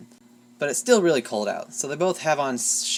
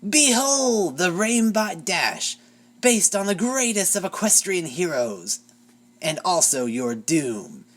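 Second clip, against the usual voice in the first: evil gloating queen; 5 semitones higher, slower than usual. A narrator's reading. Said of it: "Chrysalis crackles evilly".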